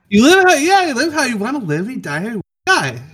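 high, fast tiny fairy voice. Acted. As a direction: squeak it quickly like a tiny fairy. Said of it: "Do you guys like my fairy voice?".

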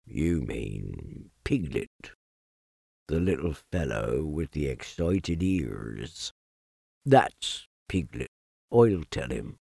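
low, quiet, glum donkey; gloomy donkey. Acted. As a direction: mope it out low and soft.

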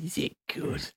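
breathy whisper, no voice at all; whispered echo. Real, not acted. Whispered echo teasing about the Steam Deck. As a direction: whisper the question back. Echoing question.

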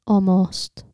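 usual speaking voice

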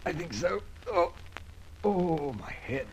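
high, pained groaning words; dazed and hurting from the blow; groggy pain. Acted. Knocked-out detective coming to, groaning in pain.

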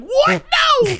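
high shocked protest; high outraged no. Retold or imitated. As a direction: protest in disbelief. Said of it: "I'd be like".

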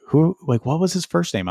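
high, questioning coworkers; incredulous question. Retold or imitated. Coworkers quoted asking.

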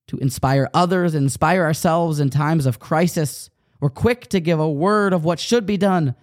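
usual speaking voice

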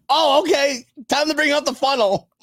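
high, chirpy pretend cue; hammed-up eagerness; mock eager announcement. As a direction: play it up high, as if springing a party idea. Acts out imagined car-ride moment.

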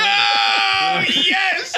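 loud, high whoop; excited shout. Real, not acted. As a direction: cheer it out gleefully. Cheering a giggity joke.

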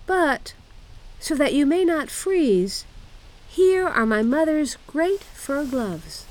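put-on high-pitched bossy child; little robber girl. A narrator's reading. Robber girl dresses Gerda for the journey, bossy. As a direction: instruct high and girlish. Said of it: "she said".

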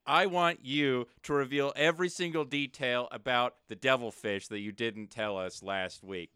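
normal talking voice

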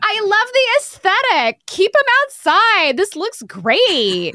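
over-the-top mock enthusiasm; mocking gushing fan. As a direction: gush like a fake fan, stretched out. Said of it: "been like".

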